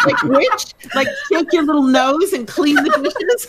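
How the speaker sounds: laughing. Real, not acted. Teasing joke.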